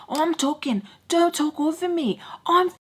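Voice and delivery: high, insistent mock anger; imitated pissed-off. He wants her to stop talking over him, imitated anger. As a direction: act out his irritated demand to be heard.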